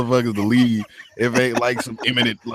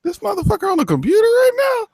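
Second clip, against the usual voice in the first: shocked, pitched way up; disbelieving falsetto question; incredulous high squeak. Retold or imitated. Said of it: "I'm like".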